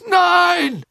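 high, loud cry; desperate shout. A narrator's reading. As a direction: yell it straining at chains. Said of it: "rief Karkaroff". (verzweifelter Schrei)

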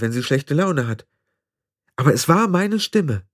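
agitated. Acted. Tiffany insists, upset.

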